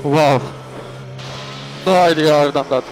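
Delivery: loud, amazed radio call; amazed shout. Real, not acted. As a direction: shout in disbelief. Winning driver on team radio.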